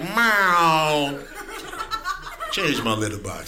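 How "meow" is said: mock cat meow; a lazy mock meow. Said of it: "They be like".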